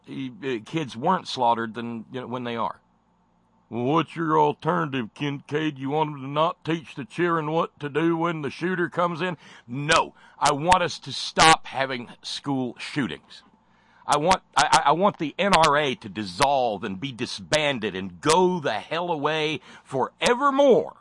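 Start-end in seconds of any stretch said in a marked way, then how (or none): 3.7-9.4 snide, louder and higher quoting of a gun defender; mock critic voice. Imagined critic arguing back. 9.7-21.0 angry outburst. Real, not acted. Ranting reply against gun lobby.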